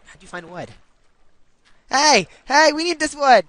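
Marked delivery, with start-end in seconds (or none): 1.9-3.4 loud, high shout of alarm. Real, not acted. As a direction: yell to stop the teammate. Teammate taking foundation wood, calling.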